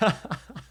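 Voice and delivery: high, loud burst of laughter; laughing. Real, not acted. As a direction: laugh at a failed accent attempt. Laughs at failed accent attempts.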